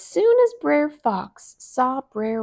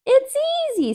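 Brer Rabbit; 4 dB louder, much faster than usual. Acted. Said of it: "said Brer Rabbit".

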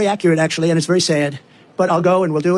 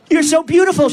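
higher-pitched, lightly acted exclamation; a touch of exclamation, pitched up; crying out; 8 semitones higher, 4 dB louder than usual. A narrator's reading. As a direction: cry it out as the woman in the poem. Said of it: "she cried".

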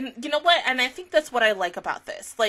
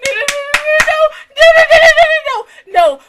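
the no's climb higher and louder into a cry; escalating no's; 11 dB louder than usual. Real, not acted. Recalling her mom during a sad scene, rising panic. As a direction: spiral up into a panicked wail.

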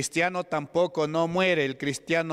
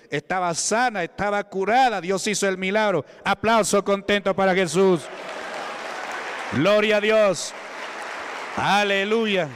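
preaching at full force; 3 semitones higher than usual. Acted. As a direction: lift the whole church into applause. Preacher rouses congregation to applause.

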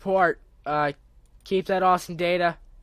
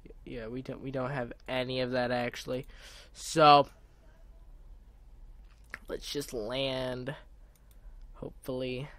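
normal talking voice

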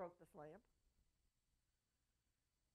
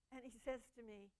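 ordinary talk, nothing marked

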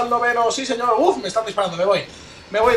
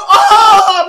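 loud high drawn-out "aah"; outraged scream; 10 semitones higher, 11 dB louder than usual. Real, not acted. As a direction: cry out at being robbed. Kill stolen from him.

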